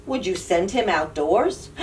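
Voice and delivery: ordinary delivery, nothing marked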